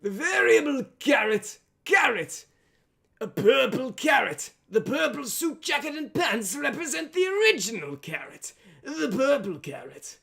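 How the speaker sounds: high, loud manic cartoon vegetable; Variable Carrot voice. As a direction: squeal it out like an excited talking carrot. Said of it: "the way I say carrot in Variable Carrot's voice".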